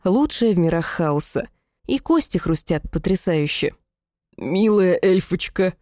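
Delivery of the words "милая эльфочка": медленно, сипло, с натугой от боли; прохрипеть сквозь боль; хрипло. A narrator's reading. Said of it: "прохрипел демон". (хриплым, сдавленным голосом)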